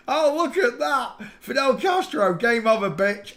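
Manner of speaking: high, loud gleeful shout; triumphant shout. Real, not acted. Finally finding Castro in a photo, loud glee. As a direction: crow it out like you've won.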